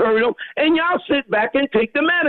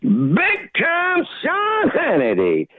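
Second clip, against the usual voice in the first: drawn-out, high hype shout; screamed greeting; 5 semitones higher, much slower than usual. Real, not acted. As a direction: scream the catchphrase, stretching every word. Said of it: "I can't scream it out".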